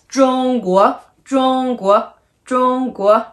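中国 with high level 中, rising 国; first and second tone. Shown as a demonstration. Teacher modelling the word for China, level then rising. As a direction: hold 中 high, lift 国 upward. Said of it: "First and second".

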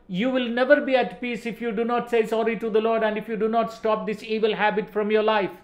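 normal talking voice

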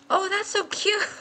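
high-pitched cooing; gushing. Real, not acted. Player seeing a cute new decoration, high gushing. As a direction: melt over something adorable.

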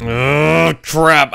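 ordinary talk, nothing marked

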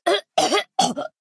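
high, spluttering coughs; pained coughing fit. Acted. Character hurt, coughing.

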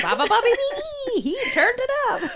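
high, loud sing-song dial pushing; laughing through a hyped-up radio jingle; playful laughing mock radio sound effect; mock radio hype. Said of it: "it'd be like".

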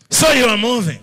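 God's voice booming down from above. Retold or imitated. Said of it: "the Lord says".

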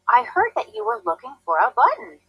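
lightly voiced chatty raccoon. A narrator's reading. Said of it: "he said".

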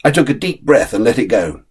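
ordinary delivery, nothing marked

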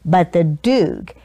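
ordinary delivery

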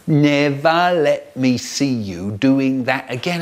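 slow, measured mock scolding; funny dad voice. Brother in bed mimicking Dad, mock stern. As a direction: imitate a telling-off dad word by word. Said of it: "I hear a funny voice going".

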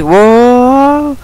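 long, very high, loud cry; high drawn-out shout of alarm; startled cry. Real, not acted. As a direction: yell out as the floor drops away. Sudden fall in the game.

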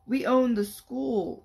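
loud, slow triumphant yell. A narrator's reading. Fifth grade boy yelling at the older kids, loud and slow. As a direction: shout it like you run the place. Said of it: "one yelled".

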